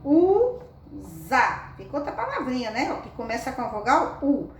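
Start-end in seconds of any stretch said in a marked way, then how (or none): 0.0-1.7 USA as a loud long U, pause, ZA; syllable by syllable. Shown as a demonstration. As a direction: sound out USA in two pieces. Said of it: "Se eu tirar a letra M".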